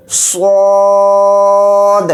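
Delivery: سواد read thick and heavy, drawn slowly; heavy full-mouth s. Shown as a demonstration. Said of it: "اس کو موٹا یعنی پر پڑھنا ہوتا ہے". (سواد کو موٹا یعنی پر پڑھیں)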